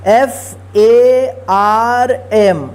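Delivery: letters spelled out slowly one by one; slow spelling. Shown as a demonstration. Teacher spells word letter by letter.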